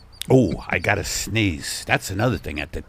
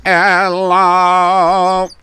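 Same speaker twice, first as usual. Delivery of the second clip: high, loud, droning alien voice; drawn-out Italian alien call; 12 semitones higher, 8 dB louder, much slower than usual. Acted. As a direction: hold one high buzzing tone like an alien. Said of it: "That's an Italian alien".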